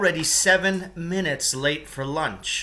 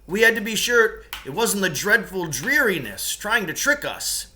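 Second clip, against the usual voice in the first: higher, quick, louder apology; cave mate explaining; 7 semitones higher, 4 dB louder than usual. Acted. Rescuer explaining to Lance why they waited, fast and high. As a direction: justify the wait hurriedly.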